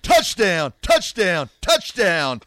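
loud, high play-by-play call; excited announcer. Retold or imitated. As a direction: belt it like a stadium announcer. Birthday callout, play-by-play imitation.

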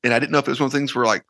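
ordinary delivery

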